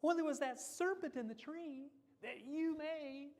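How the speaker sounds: high, put-on excuse-making voice; Eve shifting blame. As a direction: pass the blame on to the serpent. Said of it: "And the Eve".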